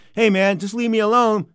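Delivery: high, loud, slow plea; whiny brush-off. Retold or imitated. Fighter to pestering host, loudly fed up. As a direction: drag it out to get rid of someone.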